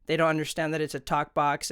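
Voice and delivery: normal talking voice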